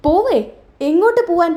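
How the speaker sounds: high-pitched disbelief; acted astonished echo; startled questioning. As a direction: ask as if caught off guard. Acted shock at her leaving.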